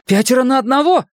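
fast, high, panting; breathless challenge; out of breath. Acted. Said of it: "тяжело дыша спросил Майк".

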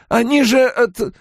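high-pitched innkeeper's objection; protesting, cut off. A narrator's reading. Innkeeper tries to explain to Rand, protesting. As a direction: protest until interrupted. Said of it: "протестовал Куале".

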